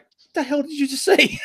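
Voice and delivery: can't believe what he just heard; stunned disbelief. Retold or imitated. Hemsworth to the actress after her snap, mock stunned disbelief.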